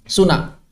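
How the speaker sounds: "Suna" with n hummed through the nose; nasalised, no D. Shown as a demonstration. Teacher demonstrating Sundanese speech, nasal "Suna" without d. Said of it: "masukkan ke rongga hidung".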